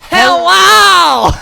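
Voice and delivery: very high, loud, bursting hello; overexcited hello. Retold or imitated. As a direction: shriek the greeting with manic cheer. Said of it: "nailed that impression".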